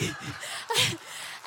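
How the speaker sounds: high unvoiced laugh, half crying; breathy laugh-cry. Real, not acted. Mother laughing nervously while telling about the game, breathy laugh-cry. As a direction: laugh through tears.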